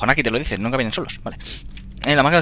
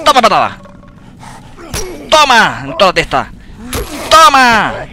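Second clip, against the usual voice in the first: loud higher shouts with each blow; triumphant yells; 10 semitones higher, 9 dB louder than usual. Real, not acted. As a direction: cheer every hit. Golpea enemigos en el juego. (gritos de triunfo)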